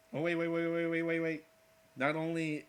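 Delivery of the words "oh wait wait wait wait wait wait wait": rapid, loud string of waits; eager, loud stammer of waits; excited. Real, not acted. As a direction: stop everything in excitement. Excited stopping himself.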